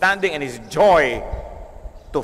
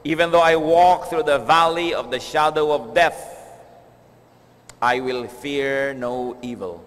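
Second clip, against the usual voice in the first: psalm reading; 2 semitones lower, slower than usual. Acted. Preacher reads Psalm twenty-three, slow and hushed. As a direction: read it slowly, letting it fall away.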